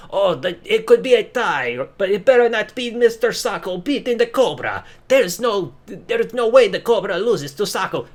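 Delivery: a lightly put-on Santino voice; imitating Santino. Santino urging fans to vote, a light mock impression. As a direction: lightly voice the pleading wrestler. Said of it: "saying, like".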